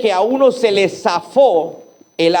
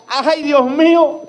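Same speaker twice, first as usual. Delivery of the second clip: high, slow cry of dismay; mock cry; 8 semitones higher, slower than usual. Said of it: "por eso él grita". (grito agudo y lento)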